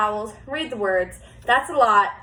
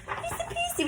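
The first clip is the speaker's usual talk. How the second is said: high singsong "easy peasy"; cheery lilt; 8 dB quieter than usual. Real, not acted. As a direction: make it sound light and easy. Teacher cheering kids.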